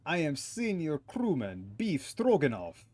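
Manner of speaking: put-on huffy Russian-accented sailor. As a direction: play a stubborn Russian crewman. Voices Russian sailor character.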